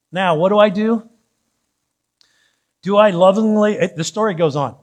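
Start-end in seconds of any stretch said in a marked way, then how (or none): none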